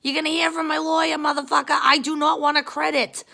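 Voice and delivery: high, loud re-enacted tirade; angry screaming. Customer demands refund from airline, retold angry screaming. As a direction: scream it down the phone, outraged. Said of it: "I was, like, screaming at American Airlines".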